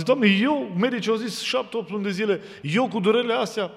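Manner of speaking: high, loud desperate plea; crying out to God. Retold or imitated. Sick man pleading with God at night, loud and high. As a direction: cry out to God in pain. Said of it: "Am zis".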